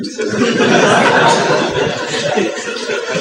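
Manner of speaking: a long airy amused laugh; real soft laughter; breathy laugh. Real, not acted. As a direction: let a soft laugh run out. Laughs after entertainment remark.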